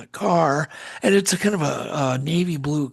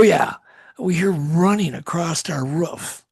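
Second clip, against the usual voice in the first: ordinary talk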